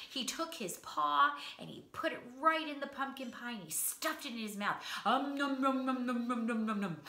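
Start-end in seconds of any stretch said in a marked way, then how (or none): 5.1-7.0 playful nom nom chewing sounds; munching noises. Acted. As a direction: gobble it up noisily. Bear stuffs pie in mouth.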